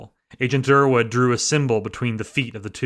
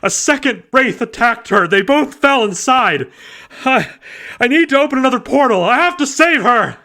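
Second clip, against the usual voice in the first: high, loud, halting grief; barely holding it together; distraught, shaken; 12 semitones higher, 6 dB louder than usual. A narrator's reading. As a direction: agent reporting his partner's loss to dispatch. Distraught agent reporting on phone.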